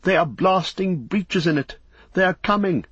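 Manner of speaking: high, urgent cry of warning; alarmed cry. A narrator's reading. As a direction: cry out that danger is near. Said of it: "men cried".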